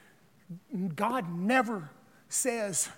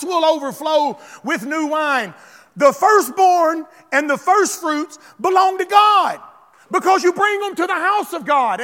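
loud, raised preacher's cry; full-force preaching; 6 semitones higher, 9 dB louder than usual. Acted. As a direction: drive the scripture home at a shout.

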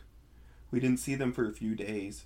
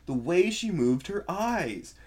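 slow emphatic exclamation, louder and higher; a touch of alarm about her eyes; exclaiming; 7 semitones higher, 7 dB louder, slower than usual. A narrator's reading. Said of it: "Lori exclaimed".

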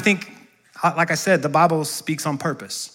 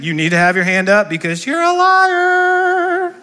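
teasing jab; 12 semitones higher, slower than usual. Real, not acted. Teasing congregation who won't raise hands.

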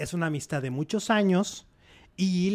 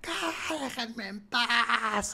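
retold yell; 6 semitones higher, 5 dB quieter, much slower than usual. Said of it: "y que grita".

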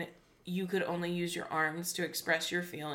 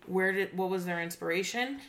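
usual speaking voice

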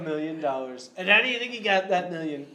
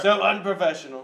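a bit louder Trump scolding; Trump impression; 6 dB louder than usual. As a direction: Trump disdain, pushed harder. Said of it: "President Trump here".

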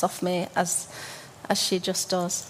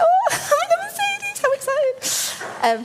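excited squeal. Retold or imitated. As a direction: squeal with giddy teenage delight. Retells excitement at a Mercedes ride.

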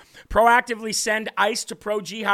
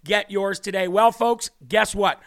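ordinary talk

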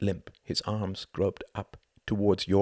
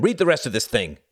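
loud, higher-pitched impatient order; angry shout; 7 semitones higher, 10 dB louder than usual. A narrator's reading. Jorgen thrusts the paper at Klaus, angry shouting. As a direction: snap loudly at your underling.

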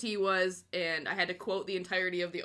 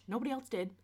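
ordinary talk

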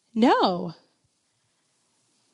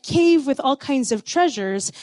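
ordinary talk

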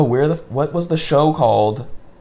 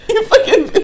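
high, loud shriek through laughter; laughing outburst; 16 dB louder than usual. Real, not acted. Friend cracks up at co-host, laughing shout. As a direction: cackle while swearing at a friend.

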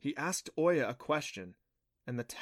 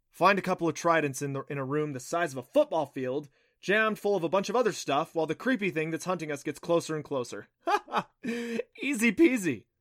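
raised, loud sarcasm capped with a mock laugh; sarcastic exasperation; 5 semitones higher, 5 dB louder than usual. A narrator's reading. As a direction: rant at the impossible task, ending high. Sarcastic outburst at impossible task.

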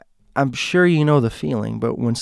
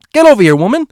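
much higher, much louder demand; impatient yell; 12 semitones higher, 11 dB louder, much faster than usual. Retold or imitated. Impatient teen yells at her mom, loud. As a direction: bark it at your mom. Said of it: "I had no patience for her".

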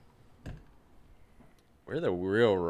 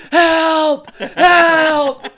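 much higher and louder, laughing hammed-up cry for help; mock desperate plea; 15 dB louder, much slower than usual. Joking about his safe word, he fakes a desperate cry. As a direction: ham up a panicked scream for help. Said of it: "It's a whole fucking paragraph".

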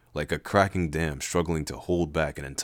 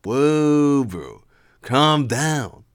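chiding; 7 semitones higher, 7 dB louder, much slower than usual. Acted. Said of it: "Levi chided".

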